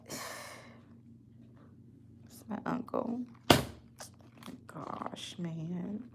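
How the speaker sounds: a faint, defeated whisper; whispered aside. Real, not acted. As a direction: let it slip out in a whisper. Grieving aside.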